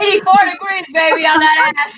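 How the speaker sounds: teasing brag. Real, not acted. Joking about hot temperature.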